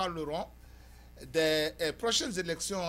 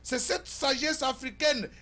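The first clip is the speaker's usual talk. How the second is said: loud, high, emphatic oratory; forceful editorial monologue; 6 semitones higher, 5 dB louder than usual. Acted. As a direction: declaim to the viewers in punched phrases.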